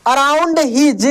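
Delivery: ordinary talk, nothing marked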